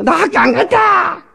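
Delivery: put-on high, slow whiny child voice; child refusing school to get something, high and whiny; sulky child. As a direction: whine like a spoiled kid.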